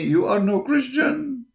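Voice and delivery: high, slow sneering mimicry of a critic; high, slow mock-indignant jeer; mocking critic's voice. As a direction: ape the accuser, pitched well up and drawn out. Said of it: "they say".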